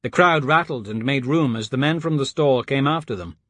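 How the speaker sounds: usual speaking voice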